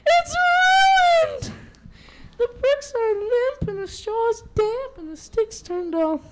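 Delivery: very high, loud wailing lament; dismayed mother wolf. Acted. Mother wolf lamenting the ruined salad, high wailing. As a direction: wail over the spoiled dinner. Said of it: "exclaimed Mother Wolf".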